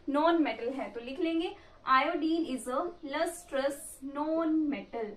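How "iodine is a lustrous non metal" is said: slow, spaced-out dictation; measured word-by-word note dictation. Real, not acted. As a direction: dictate it slowly so students can write. Dictating notes for students to write.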